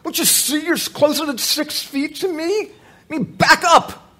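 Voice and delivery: high, loud, far-off angry shout; angry stranger. Retold or imitated. Stranger in the grocery line yelling to back up. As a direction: bark at him to back off. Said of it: "the guy turns around and goes".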